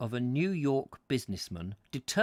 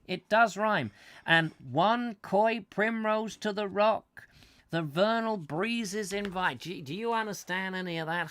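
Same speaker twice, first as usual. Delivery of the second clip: put-on American accent; 6 semitones higher than usual. American tourist raving to his wife about the poem, in an American accent. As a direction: gush like a New York businessman. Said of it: "I should do this in an American accent".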